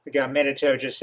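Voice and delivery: usual speaking voice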